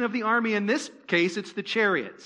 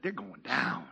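low, dramatic voice of God pronouncing doom; God's voice; 9 semitones lower, 9 dB quieter than usual. Retold or imitated. Preacher voicing God to the congregation, low and dramatic. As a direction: drop low and declare it like God. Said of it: "That's probably not exactly how he said it".

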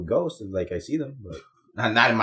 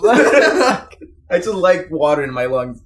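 laughing; 4 semitones higher, 5 dB louder, much slower than usual. Real, not acted. Joke said through laughter.

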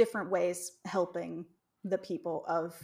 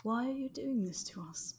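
mock English settler plea; English accent; 5 semitones higher, 4 dB quieter than usual. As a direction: plead it in a put-on English accent. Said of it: "Can we get that in an accent?".

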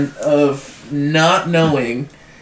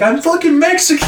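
high, loud exasperated retort; exasperated protest; 13 semitones higher, 4 dB louder than usual. Retold or imitated. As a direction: insist on it, fed up. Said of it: "Devin was always like".

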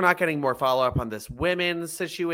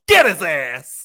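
slow, loud, raised-pitch shout; imitated yelling; 4 semitones higher, 10 dB louder, slower than usual. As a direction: drag out the hollered command. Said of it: "Shaniqua's still yelling".

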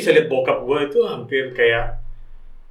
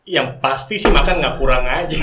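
ordinary talk, nothing marked

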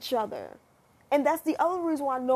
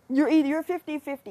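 ordinary delivery

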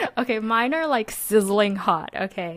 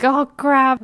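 sudden dismay; 6 semitones higher, 7 dB louder than usual. Retold or imitated. Realizing hot water is out.

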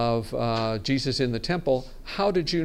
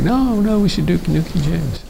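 insistent; 5 semitones higher, 7 dB louder than usual. Retold or imitated. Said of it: "we had others who were like".

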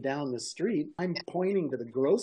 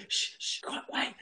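urgent whisper, acted out; whispered shushing; 6 dB quieter than usual. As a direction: shush them and whisper it, softer. Shushing the yelling passengers in story.

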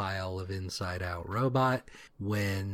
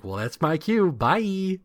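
chirpy exit; 8 semitones higher, 5 dB louder than usual. Retold or imitated. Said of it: "Rung is like".

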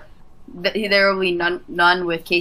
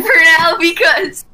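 laughing; 12 semitones higher, 5 dB louder, slower than usual. Real, not acted. Muting friend, cracking up.

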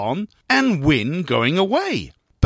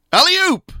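high, loud call; shout; 6 dB louder than usual. A narrator's reading. Trainer showing how to shout at the horse, high and loud. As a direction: yell it like urging a horse over a jump. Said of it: "you have to shout".

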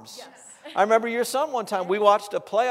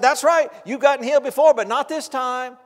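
loud, raised-pitch taunt; the devil; 5 semitones higher, 7 dB louder than usual. Retold or imitated. Preacher voices the devil denying the man healing, loud taunting. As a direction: come back hard with a higher, louder jeer. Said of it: "the devil said".

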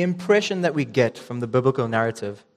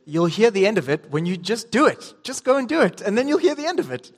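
pitched way up, imitating dad lecturing; dad's firm reply; 7 semitones higher than usual. Retelling dad's comeback to his son, mock stern and high. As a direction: play the exasperated father, half joking. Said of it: "and he just said".

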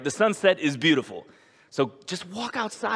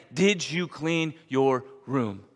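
slow gentle question; 4 semitones lower, much slower than usual. Pretend. God's voice imagined, slowed.

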